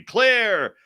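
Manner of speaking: urgent doctor yelling clear; doctor shouting. Retold or imitated. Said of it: "they have a doctor say".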